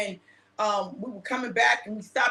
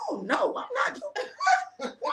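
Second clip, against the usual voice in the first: much higher, slow, laughing disbelief; retelling her own stunned reaction, laughing; shocked disbelief; 12 semitones higher, 3 dB quieter, much slower than usual. Retold or imitated. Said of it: "I said".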